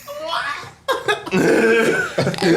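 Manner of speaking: high-pitched laughter; laughing. Real, not acted. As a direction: laugh it off helplessly. Laughing at the accent.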